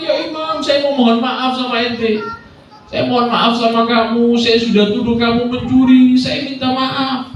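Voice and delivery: high, drawn-out plea for forgiveness; mock tearful pleading. Accuser begging the Imam's pardon, imitated tearful pleading.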